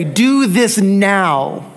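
very slow, every word weighted; urging an immediate choice, slowly; slow deliberate emphasis. Acted. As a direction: spell out do this now slowly for weight. Gloss of the Greek verb.

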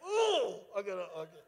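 choked-up tough guy, hammed-up; mock fighting tears. Tough guy losing his nerve, mock fighting tears. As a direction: imitate holding back tears, starting with a big ooh. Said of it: "now I'm fighting back the tears".

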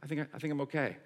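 soft, tentative relief; acted-out hushed recovery; pain subsiding. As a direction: drop quiet, cautiously testing if it's over. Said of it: "it would sort of subside".

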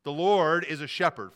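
"Lord" punched loud and held, then falling; stress on Lord. Real, not acted. Pastor restating the point for the notes, stressing "Lord". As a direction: hit "Lord" hard, then land on "shepherd". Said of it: "emphasize the word Lord".

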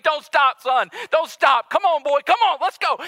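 loud, high urging call; urgent pleading. Acted. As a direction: beg him loudly to keep coming. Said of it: "he is pleading. He is begging".

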